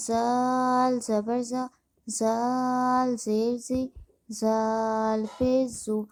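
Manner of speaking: زال held long on its aa, then the rest short; slow spelling drill, long-drawn زال; elongated madd. Shown as a demonstration. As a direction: stretch the vowel in زال for five counts. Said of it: "مد ہوگا اسے ہم پانچ الف کھینچیں گے".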